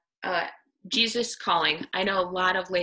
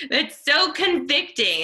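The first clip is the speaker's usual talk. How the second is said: much higher and louder, still laughing, leaning on "so"; laughing exclaim; 9 semitones higher, 6 dB louder than usual. Real, not acted. Speaker to the women's group, struck by the quote, laughing exclaim. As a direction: blurt "That's so convicting" through a laugh, "so" stretched high.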